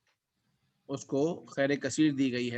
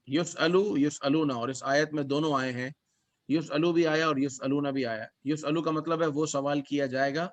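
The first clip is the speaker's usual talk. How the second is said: ordinary talk, nothing marked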